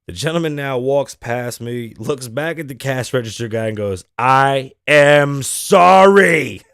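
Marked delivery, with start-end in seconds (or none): 4.2-6.6 very loud, drawn-out scream; imitating an unhinged man yelling; screamed apology. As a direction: scream each word slowly, stretching it out. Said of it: "he screams at him".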